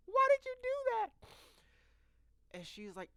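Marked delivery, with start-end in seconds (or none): 0.1-1.3 much higher, squeaky squeal; almost shrieking at her; shrieking disbelief. Retold or imitated. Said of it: "I'm like".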